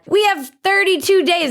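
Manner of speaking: high mock malfunction; malfunctioning robot. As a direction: imitate a glitching politician in a high voice. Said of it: "she malfunctioned like a robot".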